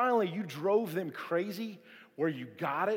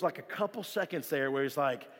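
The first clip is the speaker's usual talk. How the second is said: usual speaking voice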